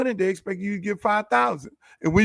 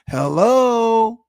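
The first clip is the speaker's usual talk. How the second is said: "Hello" drawn out, louder and pointed; emphatic call-out; 5 dB louder than usual. Acted. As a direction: call out "Hello" as if it were obvious. Emphatic repeat of the aside.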